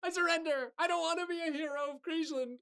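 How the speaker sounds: frightened wizard babbling his surrender; pleading surrender. Acted. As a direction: beg on your knees, voice cracking up high. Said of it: "and he goes".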